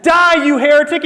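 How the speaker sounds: high, loud mock-furious yell; hammed-up zealot's cry; outraged shout. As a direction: explode in sudden righteous condemnation. Joke's punchline, pushing man off bridge.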